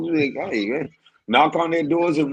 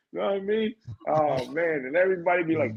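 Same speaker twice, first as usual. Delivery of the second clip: ordinary talk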